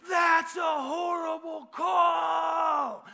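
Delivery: long, drawn-out high bellow; as loud as possible. Retold or imitated. Fan shouting at the referee in small gym, as loud as possible. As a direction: holler it slowly at full volume. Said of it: "as loud as I could".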